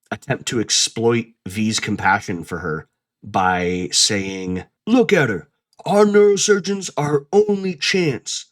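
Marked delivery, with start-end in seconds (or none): 4.8-8.4 higher, pleading mock of Reed; mock Reed plea. Mocking Reed's plea to V, higher and pleading. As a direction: ham up his desperate pitch to V.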